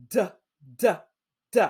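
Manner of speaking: D, d, d as a clipped, repeated /d/ sound; isolated D sound. Shown as a demonstration. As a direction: tap out the sound d three times. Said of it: "What is the beginning sound in dog".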